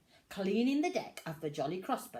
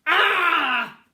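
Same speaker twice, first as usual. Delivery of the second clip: loud high shocked "Ah!"; captain's cry; 10 semitones higher, 16 dB louder than usual. A narrator's reading. Captain finding the biscuit barrel empty, loud cry. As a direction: cry out in dismay. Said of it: "cried the captain".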